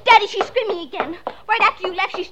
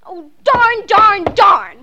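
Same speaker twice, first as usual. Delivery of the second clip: louder frustrated outburst; 2 semitones higher, 7 dB louder, much slower than usual. Acted. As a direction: stamp your foot in annoyance. Girl frustrated, alone at lot.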